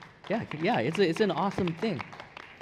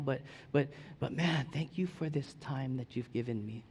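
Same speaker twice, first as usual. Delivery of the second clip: softer, tender thanks; grateful prayer; 2 semitones lower, 7 dB quieter than usual. Retold or imitated. Believer quietly thanks God in prayer. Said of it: "he wants us to be like".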